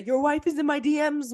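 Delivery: mock the trash-talking fighter he becomes; a quick playful jab in a brash fighter voice; Conor McGregor trash talk. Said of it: "Or are we gonna get the".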